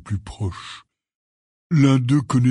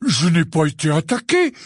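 firm, raised-pitch denial; categorical; 7 semitones higher, 4 dB louder than usual. A narrator's reading. Hagrid denies being attacked, categorical. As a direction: deny it flatly, no room for doubt. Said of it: "d'un ton catégorique".

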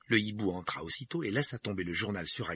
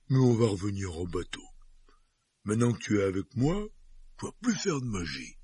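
giant voice; 4 dB louder than usual. Acted. Hagrid voiced by narrator.

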